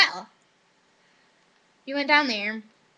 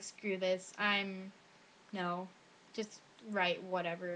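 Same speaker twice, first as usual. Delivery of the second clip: low, soft grumble to oneself; defeated muttering; 4 semitones lower, 11 dB quieter, slower than usual. Retold or imitated. Student giving up on the writing test, low defeated muttering. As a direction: mutter it as you give up.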